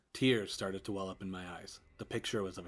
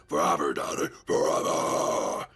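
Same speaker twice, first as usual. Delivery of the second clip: lower, very slow, loud distorted voice; demonic drawl; 4 semitones lower, 11 dB louder, slower than usual. Acted. Entity claiming the father forever, low and slow.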